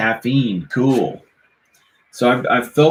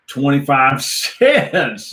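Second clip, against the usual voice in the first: a laugh breaking into the words, slowed; giggling mid-rhyme; 4 semitones higher, much slower than usual. Real, not acted.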